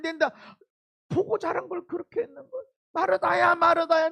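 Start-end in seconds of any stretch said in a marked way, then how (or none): 2.9-4.1 earnest plea. Retold or imitated. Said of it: "너무 간곡하게 말하는 거예요".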